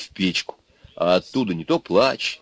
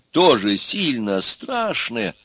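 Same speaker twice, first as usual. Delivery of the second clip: ordinary delivery, nothing marked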